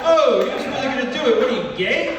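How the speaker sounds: high, loud jeering voice; mocking taunt. Comic taunting a buddy, loud high jeer. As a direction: taunt him like a shouting bro.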